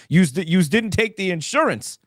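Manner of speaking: a hint of a New York accent; light New York clerk accent. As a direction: lean into the New York "yous". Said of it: "I'm doing it in my New York voice".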